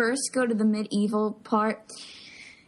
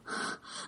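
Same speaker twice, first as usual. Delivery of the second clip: soft unvoiced gasp, as if unable to breathe; imitated breathless gasp; 13 dB quieter than usual. Fan acting out Socrates' panicked face, breathless gasp. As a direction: mimic a panicked loss of breath. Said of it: "like, he can't breathe".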